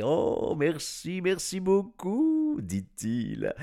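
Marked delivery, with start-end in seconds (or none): 0.0-2.6 high, drawn-out gushing thanks; overjoyed. A narrator's reading. Professor thanking the children, overjoyed. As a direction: gush gratefully, stretching the last word high. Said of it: "comblé".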